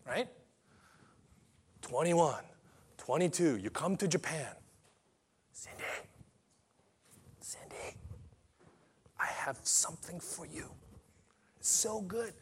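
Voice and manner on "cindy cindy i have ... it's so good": secretive soft whisper. Acted. Jesus whispering to Cindy in Japan, secretive. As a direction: lure her in with a hushed voice.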